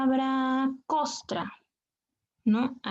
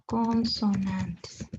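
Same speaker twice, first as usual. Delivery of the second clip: "consonantes" drawn out slowly, syllable by syllable; emphatic correction; 5 semitones lower, much slower than usual. Shown as a demonstration. Teacher correcting the slide for students, "consonantes" slowly stressed. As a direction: stretch every syllable of "consonantes" at a lower pitch. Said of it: "No cuatro vocales".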